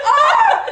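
high, loud outburst of overwhelm; mock wail. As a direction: cry out as if overcome, half laughing. Acts out overwhelmed reaction.

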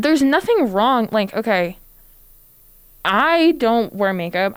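ordinary talk

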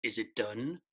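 low, soft echoing spirit voice; a hint of a ghostly voice from the dark. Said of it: "a voice echoes through the darkness".

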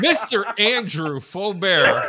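high, loud, slow announcer-style build-up; laughing hype-man intro of the guest; hype introduction. Real, not acted. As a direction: introduce him like a star.

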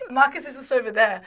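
laughing through the words. Retold or imitated. Laughing while retelling meeting husband.

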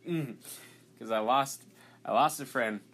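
fighting back real emotion as the words come out; getting emotional. Real, not acted. Guest recalling a friend he lost, voice welling with real emotion. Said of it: "man, I'm gonna get emotional right now".

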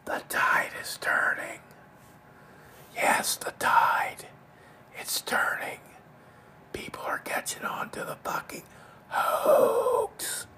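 soft creepy whisper, slow and drawn out; hushed, creepy, slow old man whisper; creepy Joe Biden voice. Retold or imitated. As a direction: breathe it like a creepy old man leaning in close. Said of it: "I'll do the creepy Joe Biden voice".

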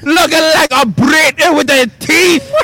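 shouted mock-Australian insult; badly faked Aussie slipping into Scottish; Australian accent. Shown as a demonstration. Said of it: "I can pull an Australian accent".

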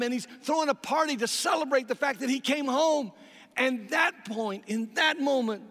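loud, high storytelling at full force; fervent preaching. Acted. Pastor booming the parable to the church, high and loud. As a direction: drive the story home to the congregation.

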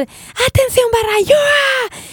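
high, drawn-out rallying yell; reenacted school cheer shout. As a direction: call the crowd to attention, stretching the end. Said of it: "empecé a gritar".

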